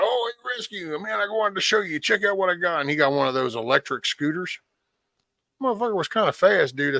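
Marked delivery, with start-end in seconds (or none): 0.0-2.8 excited buddy. Retold or imitated. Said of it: "he was like".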